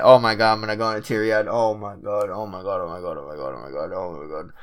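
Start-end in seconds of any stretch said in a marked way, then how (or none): 1.5-4.5 despairing moan. Real, not acted. Dreading a loss, groaning.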